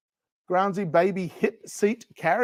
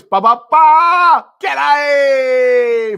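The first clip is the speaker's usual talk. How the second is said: big, high drawn-out shout; loud showman's holler; hammed-up fanfare; 9 semitones higher, 10 dB louder, much slower than usual. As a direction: belt out a comic fanfare and greeting. Mock fanfare and greeting for joke.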